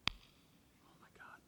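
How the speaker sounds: barely audible, drawn-out whisper; hushed, embarrassed aside; mortified whisper.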